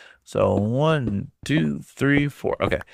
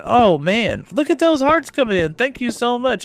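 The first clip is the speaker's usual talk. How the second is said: excited; 11 semitones higher, 3 dB louder than usual. Real, not acted. Streamer sees hearts flood in, excited. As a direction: pitch jumps higher, thrilled at the flood of hearts.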